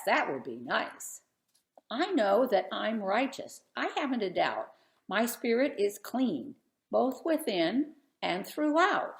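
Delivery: lively sing-song storybook reading; rhythmic picture book read-aloud; reading a rhyming story aloud. Acted. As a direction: read the verses to a room of kids. Reads rhyming Job picture book.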